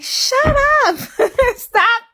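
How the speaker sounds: teasing impression of the husband cutting her off; imitating husband. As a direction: squeak out his "shut up" in a silly voice. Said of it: "he like".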